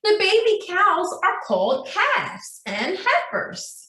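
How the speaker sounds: usual speaking voice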